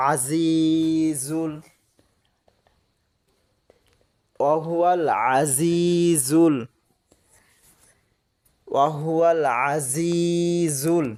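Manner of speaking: ওয়াহুয়াল আজিজুল drawn out slowly, each syllable held; slow careful reading. Shown as a demonstration. Teacher reading the joined word for students, slow and careful. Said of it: "spelling then joined reading".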